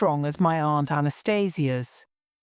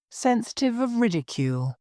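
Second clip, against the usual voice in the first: plain reading voice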